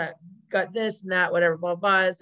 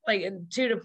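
ordinary talk

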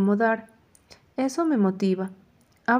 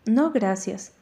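usual speaking voice

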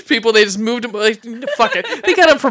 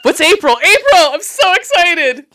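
excited; 9 semitones higher, 7 dB louder than usual. Acted. Hosts hyping next month's theme.

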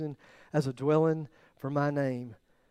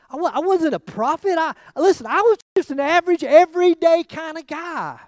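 rising insistence; 10 semitones higher, 7 dB louder than usual. Retold or imitated. Preacher voicing Nehemiah's humility.

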